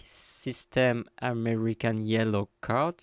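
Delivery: slow, careful English accent on the name. Shown as a demonstration. Showing bridge viewers his English accent on the system's name. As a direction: say the system name the way an anglophone would, deliberately slowly. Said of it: "vous profitez aussi de mon accent anglais".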